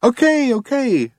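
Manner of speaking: high-pitched, wounded concession; hurt. A narrator's reading. Computer told to shut up by Zaphod, hurt. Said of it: "in a hurt tone of voice".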